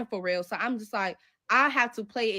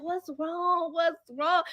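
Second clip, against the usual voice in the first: higher, pretend worried; mock concerned friend; 6 semitones higher, much slower than usual. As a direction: act alarmed for your friend. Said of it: "I started crying".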